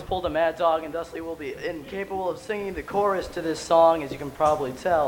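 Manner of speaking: hoarse, voiceless, barely there; talking with a lost voice. Real, not acted. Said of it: "I also lost my voice".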